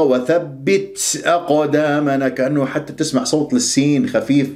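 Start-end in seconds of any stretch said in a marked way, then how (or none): 0.0-2.3 وثبت ending in a hissy ts; exaggerated hams. Shown as a demonstration. Teacher showing the overdone version, ta trailing into s. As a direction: overdo the breath after ت. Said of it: "مبالغة".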